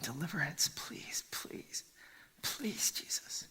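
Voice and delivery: soft, hushed, repeated begging; whispered pleading. Acted. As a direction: beg again and again, barely above a whisper. Preacher voices the man's anguish.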